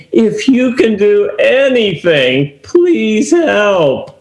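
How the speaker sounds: slow, halting plea with no faith; doubtful plea. Shown as a demonstration. Father unsure Jesus can heal son, doubtful pleading. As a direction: beg like you doubt he can do it. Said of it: "Does that sound like a guy with a lot of faith".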